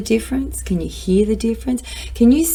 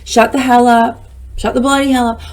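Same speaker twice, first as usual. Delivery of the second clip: mock telling-off; 4 semitones higher, 5 dB louder than usual. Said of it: "I would go".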